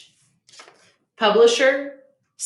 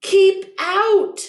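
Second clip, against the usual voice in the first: much higher, louder angry yell; grumpy cactus yelling keep out; 11 semitones higher, 6 dB louder than usual. Acted. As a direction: shout them off the land. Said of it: "yelled Hank".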